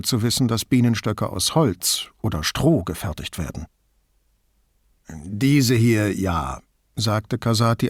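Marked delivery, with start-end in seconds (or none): 5.1-6.6 wie ertappt. A narrator's reading. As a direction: admit it reluctantly, as if found out. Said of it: "als habe man ihn bei einer Täuschung erwischt". (ertappt, leicht zögernd)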